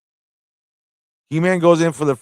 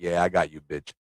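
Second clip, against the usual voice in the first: smug murmur; 7 semitones lower, 9 dB quieter than usual. Acted. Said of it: "He's like".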